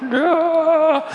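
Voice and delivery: high, held "ah" imitating stunned onlookers; comic high-pitched gasp of horror, drawn out; imitated crowd's wail; mock shocked cry.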